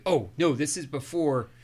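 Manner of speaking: normal talking voice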